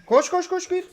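high, excited push to run; urgent urging. Real, not acted. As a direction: hurry them on, excited. Urging teammate during fight.

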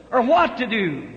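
ordinary talk

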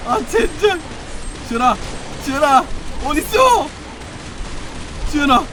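high, loud panicked shouts; frantic yelling. Real, not acted. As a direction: scream for your teammate.

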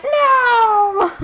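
high, loud playful yelp; mock outraged no. Imagining replying to a commenter, loud mock yell. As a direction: shriek it in fake protest. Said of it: "I'm like".

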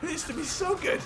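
high-pitched plaintive moan; exasperated high whine; whining complaint. Real, not acted. Complains about slow car.

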